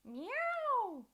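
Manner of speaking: loud high meow; cat meow. Shown as a demonstration. Teacher demonstrating the meow for kids to copy. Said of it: "So we're gonna try meow".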